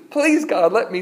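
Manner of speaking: high-pitched begging; acted plea to God; pleading. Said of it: "He's pleading".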